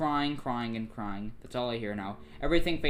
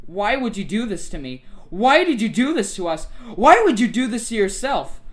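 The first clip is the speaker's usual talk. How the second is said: raised, high, building accusation; acted outburst, higher and more insistent each time; anguished confrontation; 9 semitones higher, 9 dB louder than usual. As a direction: get louder with each question, pleading angrily. Acts character confronting Monica.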